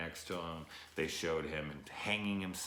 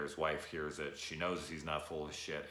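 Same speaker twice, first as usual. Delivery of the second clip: ordinary delivery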